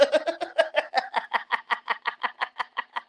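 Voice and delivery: long, trailing cackle of a laugh; wheezing laugh. Real, not acted. Streamer cracking up at a cousin's insult, long wheezing laugh. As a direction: crack up and let it run out in quick pulses.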